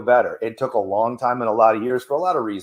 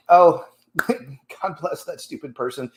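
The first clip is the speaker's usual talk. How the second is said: slow, laughing, higher mock blessing; amused blessing; 4 semitones higher, 3 dB louder than usual. Driver laughs off the stupid person who cut him off. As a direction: chuckle it out, forgiving the fool. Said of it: "Now I just think".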